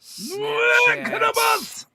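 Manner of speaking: very high, loud announcer shout. Pretend. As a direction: boom the brand name out.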